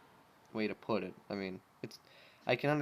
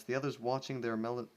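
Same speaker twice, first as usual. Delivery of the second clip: plain reading voice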